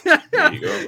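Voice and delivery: laughing hard through the words. Real, not acted. Cohost laughing at the banter, laughing loud. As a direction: blurt it out mid-laugh, high and loud.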